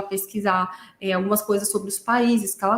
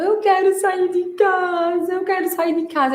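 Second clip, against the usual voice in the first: imitated tearful whine, pitched up; mock crying; 8 semitones higher than usual. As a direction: stretch it into a pretend sobbing plea. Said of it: "Aí começou a chorar mais ainda".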